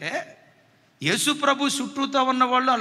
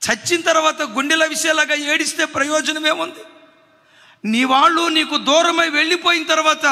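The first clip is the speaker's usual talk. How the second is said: impassioned preaching; 5 semitones higher, 6 dB louder than usual. Acted. Preacher builds to climax.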